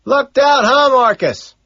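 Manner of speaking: high, loud yell; shouted at full volume; screaming. A narrator's reading. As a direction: holler it across the noise. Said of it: "he screams".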